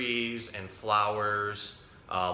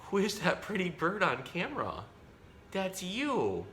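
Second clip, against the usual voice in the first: much higher cooing pet voice; talking to a pet; 10 semitones higher than usual. Real, not acted. Cooing to parrot at mirror.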